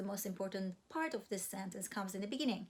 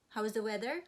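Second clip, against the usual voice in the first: normal talking voice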